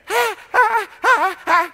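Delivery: high mock DJ laugh; radio DJ laugh. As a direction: ham up a cheesy on-air laugh.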